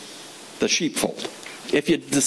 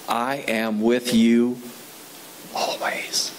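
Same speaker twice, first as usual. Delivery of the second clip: slow, hushed scripture reading; Jesus' promise read slowly and tenderly; 3 semitones lower, much slower than usual. Acted.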